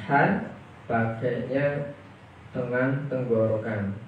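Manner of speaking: ordinary talk, nothing marked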